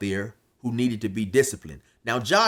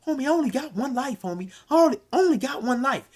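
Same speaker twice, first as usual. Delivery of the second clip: much higher, fast insistent voice; earnest homie; 12 semitones higher, faster than usual. Retold or imitated. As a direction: insist like a streetwise friend. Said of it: "he'd always say".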